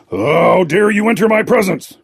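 high, loud, furious roar of a king; shouted. A narrator's reading. Gnome King to the clockwork intruder, high furious shout. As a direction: blast the intruder out of your presence. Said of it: "he shouted".

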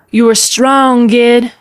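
yelled encouragement. Acted. Aunt to niece fighting the kraken, yelling. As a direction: yell it out across the water. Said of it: "yelled Garin".

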